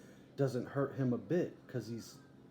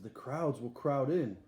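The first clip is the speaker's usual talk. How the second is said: usual speaking voice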